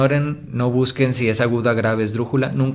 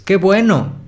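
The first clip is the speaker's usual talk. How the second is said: joyful exclamation, higher and louder; exclamación con ánimo; 8 semitones higher, 6 dB louder, slower than usual. Shown as a demonstration. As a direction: exclaim it with cheer and delight. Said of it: "como exclamando, como algo que transmite ánimo". (exclamando con ánimo y alegría)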